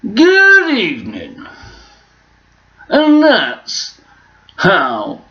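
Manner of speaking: presenter hanging on words, high and loud then dropping; slow, stretched-out TV host delivery; Jeremy Clarkson. Shown as a demonstration. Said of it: "supposed to be Jeremy Clarkson".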